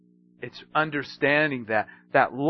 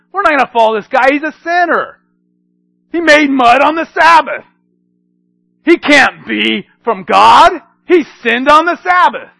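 indignant leaders; 9 semitones higher, 10 dB louder than usual. Retold or imitated. Leaders denouncing Jesus.

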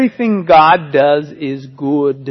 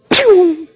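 a sharp, high whistling pew; a quick high zip noise; whizzing pew sound. Retold or imitated. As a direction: mimic wood shooting off the blade. Wood flying off the saw.

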